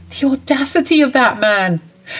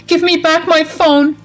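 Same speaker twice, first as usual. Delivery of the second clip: angry yelling; 6 semitones higher than usual. Acted. Mother yelling at her son to come back, angry and high. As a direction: shout after the son as the door shuts.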